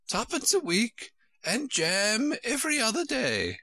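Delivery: put-on high, fluttery old queen voice; a high, flustered royal voice. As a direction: play the dithering queen, high and fussy. Queen's voice held by reader.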